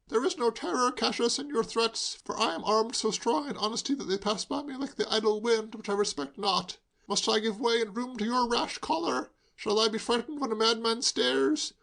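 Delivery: high, grand theatrical declaiming; tipsy Shakespeare recital. A narrator's reading. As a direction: hurl Brutus' lines at him. Hugo reciting Julius Caesar.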